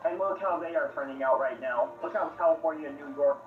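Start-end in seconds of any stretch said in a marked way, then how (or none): none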